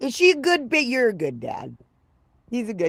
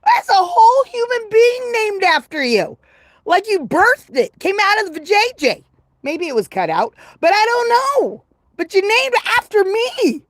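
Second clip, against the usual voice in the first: overwhelmed excitement; 8 semitones higher, 6 dB louder than usual. Real, not acted. Touched that a baby is named after her.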